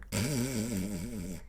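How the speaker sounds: low, buzzing fake snore; soft rumbling pretend snoring; mock snore. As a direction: drop straight into a comic snore. Acts falling asleep on the plane.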